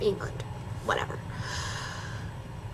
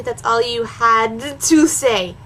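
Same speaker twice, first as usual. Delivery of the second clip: "had to say" slowed right down and stressed; drawn-out emphatic "had to say"; all-caps emphasis; much slower than usual. Acted. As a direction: lean hard and slowly on "had to say". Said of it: "The D and "to say" are in caps".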